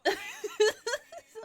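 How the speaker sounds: high, loud burst of laughter; helpless giggling burst; laughing. Real, not acted. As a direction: crack up at your own silly voice. Cracks up after own demon voice.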